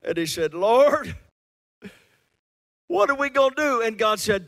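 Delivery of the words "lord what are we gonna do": high, loud plea; crying out. Retold or imitated. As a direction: call out to God in alarm. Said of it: "Moses cried out to God".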